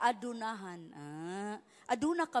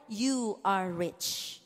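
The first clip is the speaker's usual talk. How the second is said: gentle promise; 7 semitones lower, 4 dB quieter, much slower than usual. Acted. Quoting Lord's promise.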